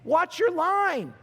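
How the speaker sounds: slow, drawn-out friendly heads-up; calm warning. Retold or imitated. To a driver cutting over the line, slow mild warning. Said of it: "I was not upset or anything".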